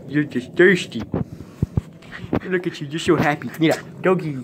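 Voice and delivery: normal talking voice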